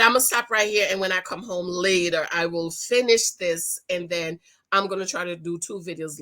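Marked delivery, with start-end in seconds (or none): none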